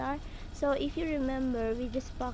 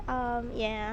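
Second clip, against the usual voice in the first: normal talking voice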